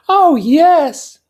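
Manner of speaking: put-on high young woman voice; Daisy voice. A narrator's reading.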